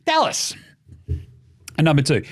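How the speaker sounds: normal talking voice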